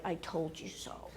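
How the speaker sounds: low, slow and very quiet; restrained I-told-you-so. Retold or imitated. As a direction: murmur it, holding back the urge. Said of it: "he's dying to say".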